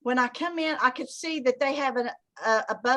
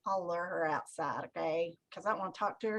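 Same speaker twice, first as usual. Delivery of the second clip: low, soft private thought; scheming aside; 7 semitones lower, 10 dB quieter, faster than usual. Retold or imitated. Plotting to lure a recruit outside, scheming aside. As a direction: drop it down like a secret plan.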